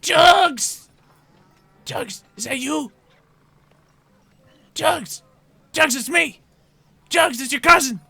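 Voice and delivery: very high, loud goblin-like calling; excited prisoner cousin. Acted. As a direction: call out urgently from a cell, high-pitched. Prisoner calls cousin from cell.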